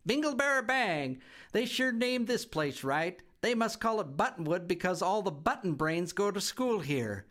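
put-on high, loud, giggling kid voice; a cheeky little girl laughing at the house; giggly bratty girl. A narrator's reading. Girl giggling at her new home, high and cheeky. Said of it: "loudly laughed".

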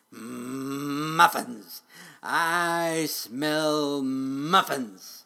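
loud, drawn-out dragon rumble; rumbling dragon. A narrator's reading. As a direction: savor the scent loudly, stretching the words like a hungry dragon. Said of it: "he rumbled".

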